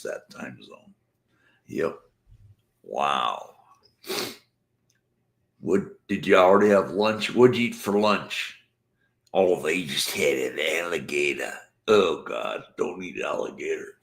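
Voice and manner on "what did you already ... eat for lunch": higher, louder, fast questions; eager questions. Real, not acted. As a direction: pepper the viewer with questions. Asking viewer eagerly.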